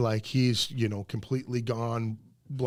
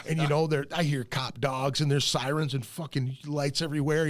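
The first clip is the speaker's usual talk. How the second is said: usual speaking voice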